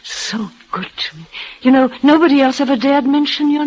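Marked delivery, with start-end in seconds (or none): 0.0-1.2 breathy hushed whisper of gratitude; soft whispered thanks to her lover. Acted. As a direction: whisper it, barely voiced. Tender aside about her maid.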